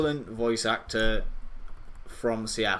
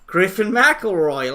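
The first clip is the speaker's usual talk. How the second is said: slow, louder, higher put-on voice; hammed-up podcaster impression; Griffin McElroy voice; 8 semitones higher, 9 dB louder, slower than usual. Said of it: "like this".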